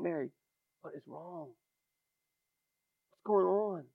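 soft, hushed and tender; Jesus softly asking Mary what is wrong, with compassion; compassionate. Acted. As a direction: comfort her quietly. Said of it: "The compassionate Jesus".

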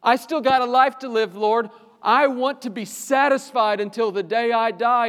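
high, loud impassioned plea; crying out. Acted. Preacher voicing the psalmist crying out for satisfaction. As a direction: cry out to be satisfied. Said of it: "just cry out in continuation".

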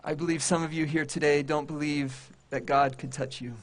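normal talking voice

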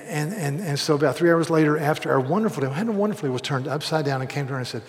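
usual speaking voice

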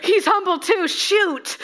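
high, loud, whiny mock panic; mock dismay. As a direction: wail in comic horror at yourself. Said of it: "And I'm like".

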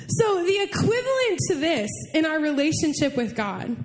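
usual speaking voice

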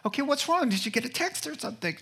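high, rapid-fire worried questions; anxious husband. Retold or imitated. Husband guessing why his wife cries, high and anxious.

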